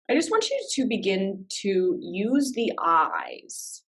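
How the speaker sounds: unhurried meditation guide with long pauses; calm, spacious instructions, leaving silence between phrases; guided meditation. Real, not acted. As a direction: ease listeners gently into body awareness.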